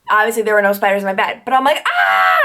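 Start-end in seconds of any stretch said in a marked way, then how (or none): 1.9-2.4 very high-pitched retold shriek; imitated scream, high but held back; mock scream. Seeing imaginary spiders in bed, high mock scream. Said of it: "I start screaming even more".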